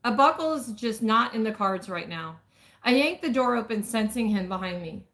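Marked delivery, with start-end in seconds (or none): none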